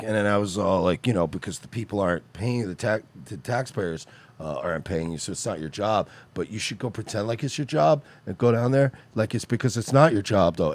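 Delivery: low, soft, halting mock recollection; mock vague, trailing ramble; can't remember, rambling. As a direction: imitate someone fumbling through a made-up story. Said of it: "he can't even remember what was said".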